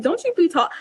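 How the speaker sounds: high-pitched, indignant retort; standing up for herself. Retold or imitated. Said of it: "standing up for myself".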